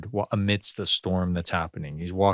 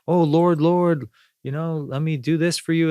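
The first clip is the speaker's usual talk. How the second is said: higher, louder, eager-to-please disciple voice; fawning apostles; 8 semitones higher, 3 dB louder than usual. Retold or imitated. Apostles pleading to serve their Lord, high and fawning. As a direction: gush over him like a devoted follower.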